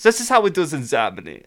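high, loud German-accented woman; Nazi McGonagall, German accent. Shown as a demonstration. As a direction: bark it in a feminine German accent. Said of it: "Professor McGonagall is a Nazi".

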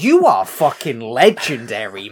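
slow, punchy declaration of awe; loud admiration. Retold or imitated. Fan to a character facing a gun, loud admiration. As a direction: hail a legend, loud and slow. Host cheering the film character.

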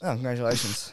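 drily unimpressed congratulations, low; sarcastic. Real, not acted. Host congratulating a co-host on his cricket connection, sarcastic. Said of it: "That sounded so sarcastic".

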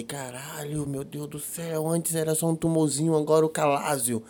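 mock private dread, softly; hushed panic. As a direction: say it under your breath, dreading it. Retells his panic at diagnosis.

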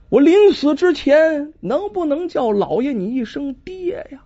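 gently pleading last wish; a hint of a dying boy. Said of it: "极其微弱呀". (临死哀求老爷)

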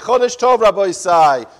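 high, loud call of Rabbi Isai; loud greeting. Acted. Host hailing the rabbi at the shiur's start, loud.

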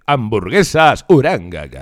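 slow, loud barker call; mock raffle announcer.

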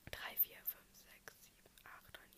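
counting under her breath; whispered counting. Real, not acted. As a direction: whisper the numbers to yourself.

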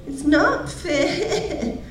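put-on high, very slow child's whine; drawn-out sulky complaint; whiny child. Whinging entitled child voiced.

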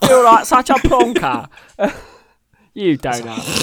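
hammed-up cockney "plonker" and "donut"; mock London accent, clearly amateur; bad London accent. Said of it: "Horrible accent".

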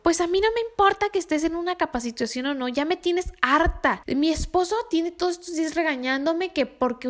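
mock furious tirade, words tumbling out; angry client rant. Angry client on the phone scolding Mayra, heated imitation.

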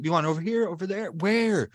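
high, exasperated rapid-fire questions; fed-up mock outburst; retold flipping out. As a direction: re-enact demanding an answer, pitch way up. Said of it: "flipped out".

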